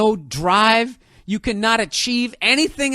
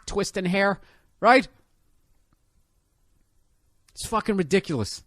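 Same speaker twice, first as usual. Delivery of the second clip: usual speaking voice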